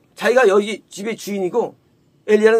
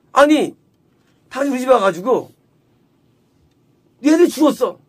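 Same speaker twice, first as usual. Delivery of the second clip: louder, raised indignant complaint; acted confrontation; 3 semitones higher, 3 dB louder than usual. Woman blaming Elijah after coming to her house, confrontational. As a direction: take him to task, imitating an aggrieved mother. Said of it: "따지는 거예요".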